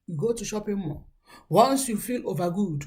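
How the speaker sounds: normal talking voice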